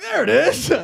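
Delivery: loud, high exclamation; mock triumph. Comic answering the Uber driver, mock triumphant shout.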